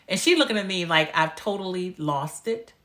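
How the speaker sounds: ordinary talk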